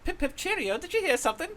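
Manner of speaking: very high, hammed-up British voice. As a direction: put on a silly, high British accent. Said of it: "do the British accent one".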